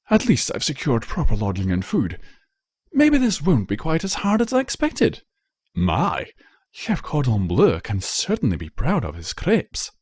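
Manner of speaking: lightly voiced pompous noble; self-satisfied nobleman musing aloud; haughty prince. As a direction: sound pleased with himself. Prince Blueblood's voice in story.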